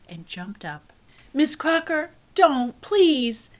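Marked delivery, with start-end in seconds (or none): 1.3-3.5 high, loud pleading cry. Acted. Cassie pleading with teacher to spare her brother, crying out. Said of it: "I cried".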